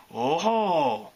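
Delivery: put-on long, appreciative accented exclamation; approving. A narrator's reading. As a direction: draw it out, pleased with the view. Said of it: "Photo Finish said approvingly".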